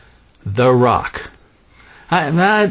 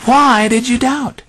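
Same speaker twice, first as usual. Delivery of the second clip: higher, slow, searching question; Jesus rebuking Peter; 10 semitones higher, slower than usual. Acted. Jesus questions sinking Peter, higher and slow. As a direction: press Peter on his doubt. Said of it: "He said".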